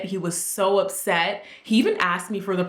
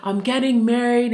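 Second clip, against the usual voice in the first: excited announcement; 4 semitones higher, 3 dB louder than usual. Retold or imitated. Telling mom excitedly as a teen.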